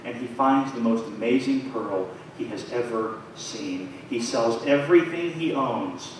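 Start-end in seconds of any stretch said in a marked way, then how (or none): none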